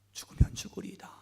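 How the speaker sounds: hushed whisper of resolve. Acted. Jacob whispering his resolve, ready to die. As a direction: breathe the vow.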